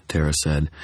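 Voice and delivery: usual speaking voice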